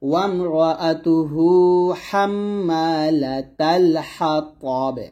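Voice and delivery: slow melodic chant of হাম্মালাতাল হাতাব; Quran recitation. Shown as a demonstration. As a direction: recite it stretched and sung.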